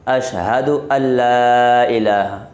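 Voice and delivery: slow "ان لا" merged into doubled l; careful recitation. Shown as a demonstration. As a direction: recite "اشہد ان لا الہ" slowly. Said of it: "لام پڑھا جائے گا".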